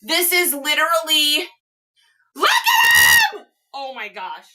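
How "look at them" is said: very high, loud, drawn-out squeal; real overjoyed outburst; ecstatic. Real, not acted. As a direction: shriek it slowly with delight. Showing off the surprise gift.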